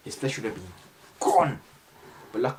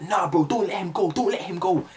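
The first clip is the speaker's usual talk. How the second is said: urging teammate; 6 semitones higher than usual. Retold or imitated. Teammate urging not to spare.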